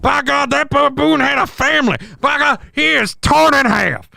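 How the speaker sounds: high, over-the-top ringside call; frantic fight commentator. Acted. Commentator watching the leopard attack a baboon, in frantic mock horror. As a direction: call the carnage like a horrified wrestling announcer.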